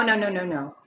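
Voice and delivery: ordinary delivery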